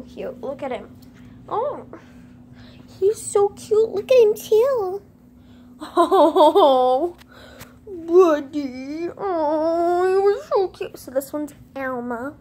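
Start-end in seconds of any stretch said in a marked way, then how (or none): 1.5-5.0 gushing. Real, not acted. Gushing over lizard. 5.8-11.0 mock crying. Emotional fake cry over cuteness.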